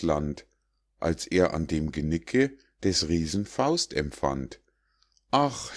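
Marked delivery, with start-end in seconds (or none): 5.3-5.7 high, frightened cry of ah; crying out in fear. A narrator's reading. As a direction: gasp it out in terror. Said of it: "schrie er". (hoher, angstvoller Aufschrei)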